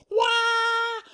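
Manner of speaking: loud falsetto wah; high cub squeal. Acted. Baby Bear's squeal.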